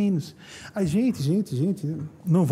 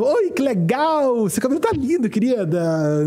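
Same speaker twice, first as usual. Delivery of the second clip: gushing mock Carioca, much higher and louder; Carioca accent; 6 semitones higher, 5 dB louder, slower than usual. Carioca woman complimenting a friend's hair, gushing Rio accent. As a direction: sing it out like a chatty Rio local. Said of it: "você falar como carioca".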